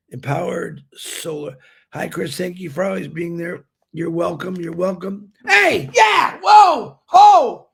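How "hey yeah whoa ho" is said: loud, high whoa; sudden loud cheer, higher and louder; excited shout. Real, not acted. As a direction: greet him with a big happy shout. Greeting co-host loudly.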